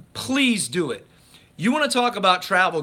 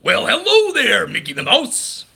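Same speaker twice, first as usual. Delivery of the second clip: loud, higher cartoon bully voice; hammed-up Pete voice; Pete impression; 5 semitones higher, 6 dB louder than usual. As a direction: greet Mickey like a smug cartoon villain. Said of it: "let's see if I can do Pete".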